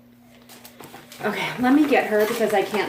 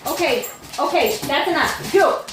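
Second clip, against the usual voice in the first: scolding the dog; 7 semitones higher than usual. Real, not acted. Scolding excited dogs.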